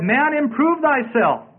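slow, high, drawn-out motto; intoned ancient maxim; proclaimed maxim. Acted. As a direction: stretch it out like a grand pronouncement. Reciting the Chinese maxim.

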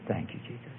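very soft, low prompt; imitating a whisper. Preacher prompting a praying girl, near whisper. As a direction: murmur it for someone to repeat. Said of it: "I began to whisper in her ear".

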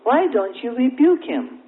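high-pitched, exasperated question; acted-out upset protest, falling at the end; disturbed plea. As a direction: demand an explanation from the Lord. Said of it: "he became disturbed in his spirit".

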